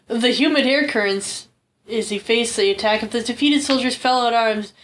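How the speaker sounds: ordinary talk, nothing marked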